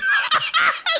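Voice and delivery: bursting into high laughter. Real, not acted. Laughing at funny video.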